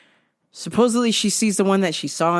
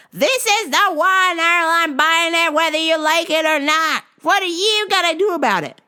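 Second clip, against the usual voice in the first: loud; 12 semitones higher, 6 dB louder than usual. Retold or imitated. Maggie telling Earl she is buying it, loud defiance. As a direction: announce it loudly and defiantly. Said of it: "she says loudly".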